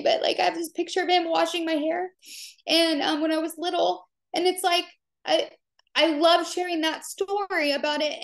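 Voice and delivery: high, tearful voice pushing through the story of her late dad; crying while talking. Real, not acted. Coach tells followers about her late dad, crying. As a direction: keep talking through real tears, voice lifted high. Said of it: "Oh God, here I am crying".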